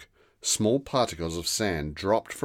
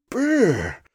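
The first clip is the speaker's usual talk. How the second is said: mock shiver, high; a hint of chattering cold; 7 dB louder than usual. A narrator's reading. Girl shivers in the sudden frost, lightly. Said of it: "said Rosa, shivering".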